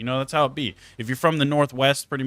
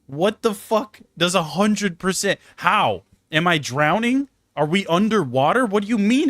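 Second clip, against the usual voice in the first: incredulous; 7 semitones higher, 3 dB louder, slower than usual. Retold or imitated. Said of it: "And I'm like".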